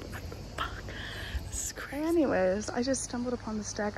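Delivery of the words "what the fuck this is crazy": breathy hushed whisper with a laugh. Real, not acted. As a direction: whisper it in awe. Whispering on a quiet deck.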